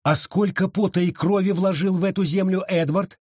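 high-pitched, challenging question; pointed reminder. A narrator's reading. Abita reminds Wallace of Edward's labour, pointedly. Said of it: "напомнила Абита".

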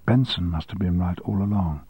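ordinary delivery, nothing marked

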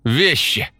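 high, indignant granny's outcry; granny's exclamation. A narrator's reading. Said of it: "всплеснула руками бабка". (возмущённый высокий бабкин возглас)

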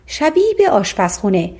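ordinary delivery

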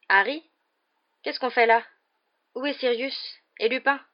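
put-on high, bewildered boy's voice; groggy questioning. A narrator's reading. Ron waking and questioning Harry, bewildered. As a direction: fire puzzled questions on waking. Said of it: "grogna-t-il".

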